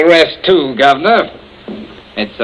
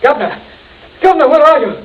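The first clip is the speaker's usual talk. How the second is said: calling out; 11 semitones higher, much faster than usual. Acted. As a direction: shout for him from another room. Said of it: "Stop shouting".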